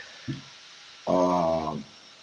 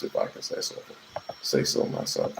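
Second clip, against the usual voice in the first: whispered aside; 9 dB quieter than usual. Real, not acted. Unvoiced trailing aside.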